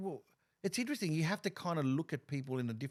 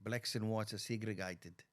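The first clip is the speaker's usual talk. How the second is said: slow, low South African accent; mock South African, slowly drawn out; 5 semitones lower, 7 dB quieter, slower than usual. Shown as a demonstration. Comic shows off his favourite accent, slow South African accent. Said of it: "I love that accent".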